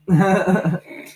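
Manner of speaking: loud high cackling laugh. Real, not acted. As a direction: burst out laughing loudly. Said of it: "you're laughing at me now".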